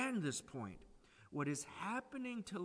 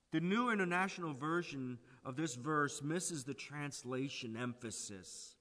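usual speaking voice

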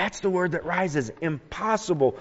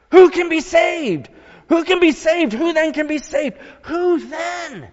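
high, loud, desperate acted cry; hammed-up terror, as if there's no hope; preacher acts the disciples' terrified cry to the congregation; 11 semitones higher, 4 dB louder than usual. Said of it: "in a manner of crying out in terror".

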